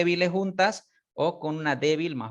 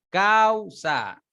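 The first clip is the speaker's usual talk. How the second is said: Causa said higher, stressing the A; model word; 6 semitones higher, 4 dB louder than usual. Shown as a demonstration. Teacher modelling open vowel. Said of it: "la A queda más abierta".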